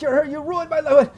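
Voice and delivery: high-pitched accusing captive; high accusation. Acted. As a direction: blurt it out, cornered. Stein accuses again.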